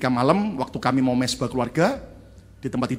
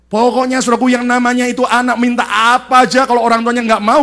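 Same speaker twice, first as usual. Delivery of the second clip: higher, louder forceful sermon; full preaching; 9 semitones higher, 10 dB louder than usual. Acted. Preacher hammering on parents who defend children blindly, loud. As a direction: drive the point home to the congregation.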